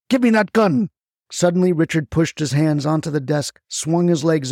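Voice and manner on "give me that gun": high, forceful order; fierce demand. A narrator's reading. Richard lunging across the desk for the gun, fierce demand. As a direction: demand it in a sudden raised pitch.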